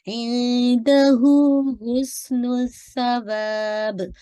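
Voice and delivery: slow chanted इंदहू हुस्नुस सवाब; tajweed recital; Quran recitation. Shown as a demonstration. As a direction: recite melodically, stop on सवाब. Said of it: "हुस, सीन पे वाज़े कीजिएगा".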